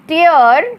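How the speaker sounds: टीयर said as "tee-yer", rising at the end; pronouncing tear. Shown as a demonstration. Teacher showing students how टीयर sounds, rising. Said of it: "इसका उच्चारण होगा टीयर".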